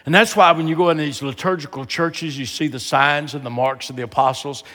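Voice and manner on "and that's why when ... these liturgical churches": ordinary talk, nothing marked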